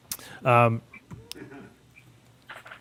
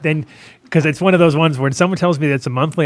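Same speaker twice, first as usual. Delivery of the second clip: ordinary delivery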